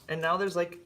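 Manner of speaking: ordinary talk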